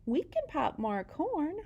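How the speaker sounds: very high comforting squeak; tiny voice calming the bear; Mouse squeaks. Acted. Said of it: "Mouse squeaks".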